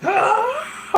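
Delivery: very high shriek, wavering into a laugh; shrieked anime blast cry. Retold or imitated. Retelling Kamehameha moment with Spencer, a high laughing shriek. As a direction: let out a laughing anime energy-blast yell. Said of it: "look at each other like".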